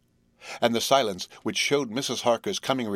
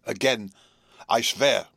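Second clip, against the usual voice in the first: resonant vow; 2 semitones higher, 4 dB louder, slower than usual. A narrator's reading. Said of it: "came the professor's resonant voice".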